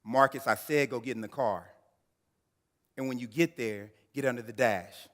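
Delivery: a mother suddenly snapping an order; stern mother. Retold or imitated. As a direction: mom ordering her son to the car and under the dash, loud and stern. Her whole countenance changed.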